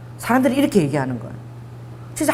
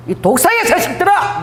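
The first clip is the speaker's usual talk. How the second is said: mock rebuke; 7 semitones higher than usual. Jesus rebuking, quoted.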